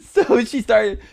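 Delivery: ordinary delivery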